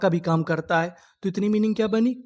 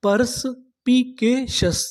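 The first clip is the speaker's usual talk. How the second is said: perspicacious drawn out very slowly, raised; slow full word; 4 semitones higher, much slower than usual. Shown as a demonstration. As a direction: stretch perspicacious out for students. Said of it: "तो पूरी meaning क्या बनी?". (धीरे-धीरे पूरा शब्द)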